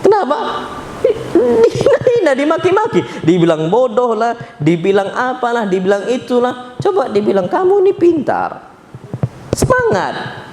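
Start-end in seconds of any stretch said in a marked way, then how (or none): 0.0-3.0 impassioned preaching. Acted. Preacher decries insulting workers and children, indignant. 7.5-10.4 high, cheerful encouragement; encouraging. Retold or imitated. Boss encourages an employee, bright encouraging voice.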